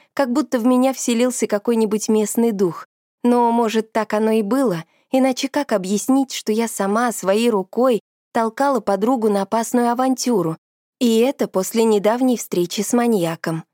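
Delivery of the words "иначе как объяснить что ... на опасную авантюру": usual speaking voice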